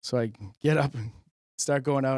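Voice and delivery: ordinary delivery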